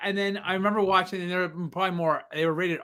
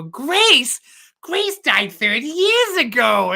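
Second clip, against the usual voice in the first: put-on high, loud old lady voice; a deaf old aunt, mishearing; higher, louder old lady quote; 7 semitones higher, 7 dB louder, much slower than usual. Acting deaf old Aunt Bethany.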